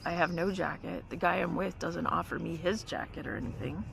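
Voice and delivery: ordinary delivery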